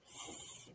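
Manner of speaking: the letter "S" as an isolated long hiss; tapped-out sound. Shown as a demonstration. Teacher taps out sing, "S" hissed on its own. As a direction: sound "S" alone as an unvoiced sss. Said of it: "So tapping it out".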